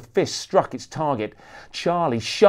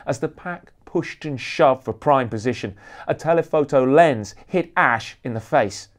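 ordinary delivery, nothing marked

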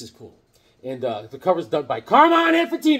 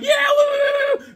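much higher, louder, drawn-out whoops; wild whooping; 7 dB louder than usual. Retold or imitated. Kid speeding on his first dirt bike ride, whooping. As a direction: holler with reckless joy. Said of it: "I'm like".

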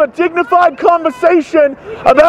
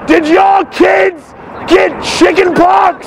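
slow, emphatic calling; slower than usual. Real, not acted. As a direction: question called out slowly, word by word. Repeats question, calling across.

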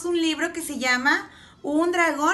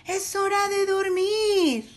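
sleepy dragons; 5 semitones higher than usual. A narrator's reading. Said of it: "Diez dragones soñolientos dicen".

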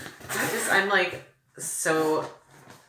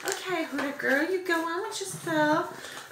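sassy cheering; 11 semitones higher than usual. Real, not acted. Vlogger cheering on a makeup brand, sassy. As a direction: cheer her on, voice way up.

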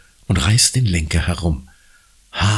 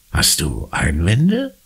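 ordinary delivery

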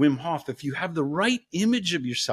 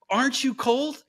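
higher teasing warlock question; 8 semitones higher than usual. Retold or imitated. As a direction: toy with the victim. Warlock questions second daughter.

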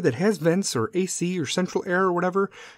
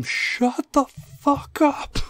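drawn-out high yell through the vent; slow, stretched shout to silence him; mock scream; 11 semitones higher, much slower than usual. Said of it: "And I scream".

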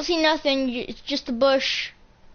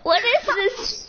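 laughing disbelief; 10 semitones higher, slower than usual. Real, not acted.

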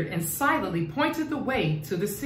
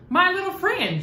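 much higher, bright delighted cry; delighted exclamation; 10 semitones higher than usual. Acted. Nyasha greets the little garden snake with a delighted, much higher exclamation. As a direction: greet him with a burst of joy. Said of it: "she exclaimed".

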